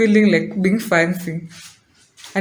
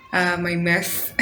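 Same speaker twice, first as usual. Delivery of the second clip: laughing; 2 semitones lower, 4 dB quieter than usual. Real, not acted. Laughs about her mess.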